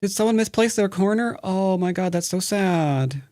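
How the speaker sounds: high, fake sympathetic tone; mock pity.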